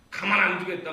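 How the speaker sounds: loud threatening vow; jealous threat. Retold or imitated. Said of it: "이런 마음이 올라오는 거예요". (질투에 찬 큰 위협)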